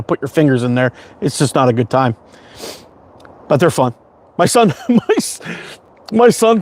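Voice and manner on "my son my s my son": breaks into a laugh mid-word. Real, not acted. Dad laughing as he starts his son's fish story.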